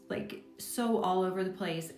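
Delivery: ordinary talk, nothing marked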